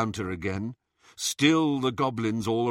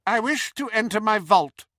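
high, loud, imperious demand; haughty Bellatrix voice; 11 semitones higher, 5 dB louder than usual. A narrator's reading. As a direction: demand it like a proud witch. Hermione playing Bellatrix.

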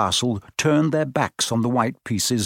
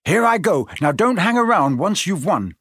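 raised, high, determined call; 7 semitones higher, 4 dB louder than usual. A narrator's reading. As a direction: call out bravely before the sacrifice. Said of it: "Ron called, his face pale but determined".

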